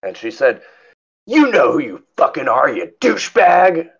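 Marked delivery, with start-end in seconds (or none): 1.3-3.9 retold indignant outburst, pitched up; mock angry scream. Furious lady answers the man behind his mask, mock scream. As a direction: imitate an irate woman, higher pitch, slowing on the insult. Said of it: "she starts screaming at me some more".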